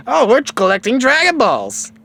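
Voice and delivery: put-on high, loud, casual kid voice; Goku. Goku beating Bulma to the jump, high and casual. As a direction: toss it out breezily in a high voice. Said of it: "all casually laid back".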